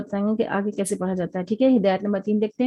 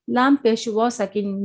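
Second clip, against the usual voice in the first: ordinary delivery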